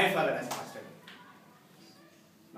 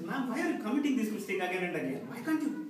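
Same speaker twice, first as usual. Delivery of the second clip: high-pitched exasperated complaint; acted anger; 6 semitones higher than usual. Employee confronting typist over repeated mistake, angry. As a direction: scold heatedly, retelling your own outburst. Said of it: "I went with full anger".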